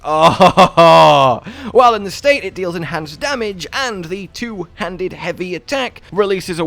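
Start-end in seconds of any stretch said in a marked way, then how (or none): 0.0-1.4 laughing "oh", much louder; a laughing burst of real delight; delighted gush. Real, not acted. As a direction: swoon over how good it looks. Gushing about the sword's glow.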